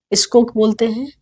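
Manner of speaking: normal talking voice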